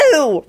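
high, drawn-out "ew"; acted screech of revulsion; screeched disgust. A narrator's reading. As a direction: squeal in disgust. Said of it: "Rosie screeched".

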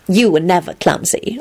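mildly reproving contradiction. A narrator's reading. Elizabeth correcting Lupin, reprovingly. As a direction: gently tell him off. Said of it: "Elizabeth said reprovingly".

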